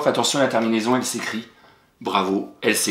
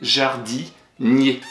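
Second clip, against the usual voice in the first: jardinier drawn out very slowly; slow spelling voice; much slower than usual. Shown as a demonstration. Teacher stretching jardinier slowly to show its spelling. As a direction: stretch jardinier so each sound is heard. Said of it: "J'écris jardin".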